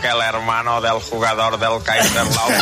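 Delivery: low, monotone self-parody; Axel Torres. Acted. Guest mimicking the show's impersonation of himself, low mock voice. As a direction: imitate your own impersonator, low and level. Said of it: "Imita tu imitación".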